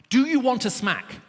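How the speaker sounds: high, loud, slow scolding; parent's threat. Retold or imitated. Preacher playing a parent threatening a child, loud scold. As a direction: bark it like a fed-up parent. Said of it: "parents ask their children stupid questions".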